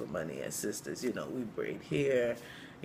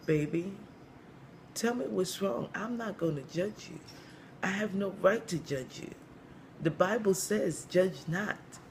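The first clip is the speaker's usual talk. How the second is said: higher, cracked voice of reassurance; cracked-voiced church mother; 7 semitones higher than usual. Church mother promising not to judge, cracked voice. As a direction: play a high-voiced church elder reassuring someone. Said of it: "she has a really cracked voice".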